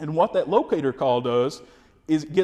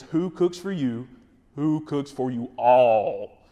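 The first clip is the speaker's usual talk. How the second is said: slow hooting owl call, pushed louder at the end; 2 semitones lower, slower than usual. Shown as a demonstration. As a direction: hoot it out to carry farther through the woods. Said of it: "if you need to get a little bit louder".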